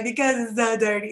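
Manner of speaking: lightly imitated tearful whine; mock boohoo. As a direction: retell it with a touch of crying. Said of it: "I was just boohoo crying".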